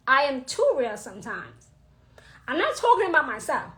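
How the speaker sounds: ordinary delivery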